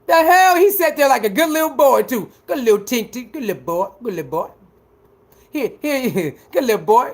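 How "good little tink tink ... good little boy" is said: put-on soft, sinking praise, like to a toddler; cooing baby talk. Streamer praising the kid in timeout, cooing softly. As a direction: coo it gently, fading away.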